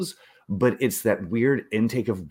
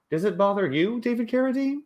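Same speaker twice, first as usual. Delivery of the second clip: mock host question. Cavett asks on talk show.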